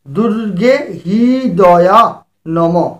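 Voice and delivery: very slow, drawn-out দুর্গে হৃদয়া নমঃ, each syllable stretched; slow demo. Shown as a demonstration. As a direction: spell out দুর্গে হৃদয়া নমঃ slowly for learners. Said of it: "এটা তাহলে করতে হবে".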